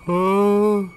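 sustained high cry of alarm. Real, not acted. Player before fleeing the room, high alarmed cry. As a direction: hold a high "uh" as danger nears.

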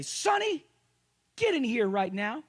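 put-on calling the child in, loud and firm; father calling his daughter from the other room; stern daddy call. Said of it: "a stern look on my face".

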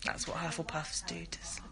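very soft whispered aside. Real, not acted. Sister making an aside about rival houses, whispering. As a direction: whisper it, breaking off midway. Aside, hushed until she shushes.

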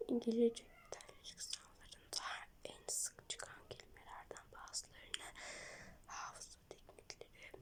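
soft unvoiced whisper. Real, not acted. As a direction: whisper the text to yourself. Reading the app text in a whisper.

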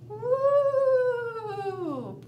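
long rising ghostly ooh; a ghost's moaning ooh; spooky ooh. Shown as a demonstration. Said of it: "sound real scary".

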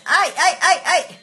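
loud high wail of ay ay ay; loud, half-laughing emotional wail; emotional cry of ay. Real, not acted. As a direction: let the ay ay ay burst out. Overcome with emotion.

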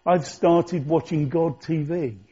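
ordinary delivery

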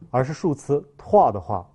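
ordinary talk

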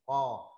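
"co" thick and loud. Shown as a demonstration. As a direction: punch a heavy, throaty "co". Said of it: "đọc dày lên". ("co" đọc dày, thật to)

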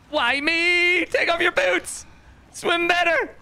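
high, hammed-up dying hero; milk the tragic last words; mock dying words. Mock dying drowning speech.

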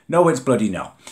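indignant exclamation; loud indignant denial. Real, not acted. Repeats denial, then loud.

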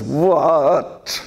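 "What?" with a laugh, a hint of teenage huff. Boy says it again to his mother, amused mock-indignant "What?". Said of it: "And then he says".

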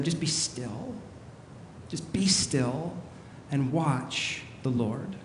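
soft, slow, spaced-out words; hushed calming. Acted. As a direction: gently settle them into stillness. Moses calming the people.

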